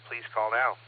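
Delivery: normal talking voice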